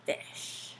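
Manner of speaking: breathy whispered single word. Pretend. Puppet's whispered clue to the children, whisper. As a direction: whisper it like a secret.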